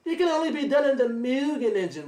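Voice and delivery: high, mocking imitation of a rival bragging; mocking a liar. Streamer mimicking a rival's false boast, jeering. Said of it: "you don't see me lying, saying".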